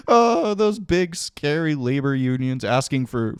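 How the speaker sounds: high, drawn-out mock whimper; mock frightened. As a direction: feign fear of a big scary threat. Mocks the company's harassment complaint.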